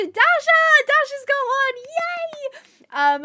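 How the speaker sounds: high, loud happy shout; excited cheer. Real, not acted. Cheering a gift recipient on stream.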